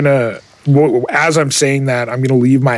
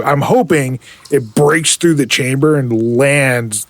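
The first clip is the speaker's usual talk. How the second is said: usual speaking voice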